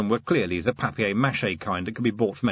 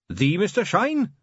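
high, slow, disbelieving question; astonished; 9 semitones higher than usual. Acted. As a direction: ask in awe. Carrot stunned by the name.

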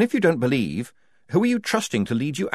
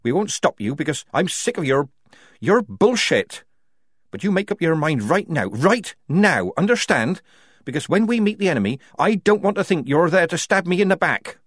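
angry ultimatum; 8 semitones higher, 4 dB louder than usual. A narrator's reading.

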